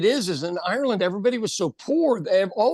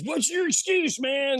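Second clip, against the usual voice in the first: mock challenging guy; 7 semitones higher than usual. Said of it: "the guy goes".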